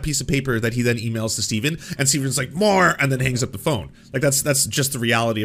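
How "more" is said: higher, curt mock demand. Imitating Steven on the phone to the negotiator, mock demanding. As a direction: demand it like a caricatured client.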